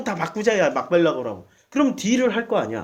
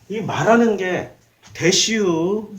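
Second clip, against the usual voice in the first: ordinary talk, nothing marked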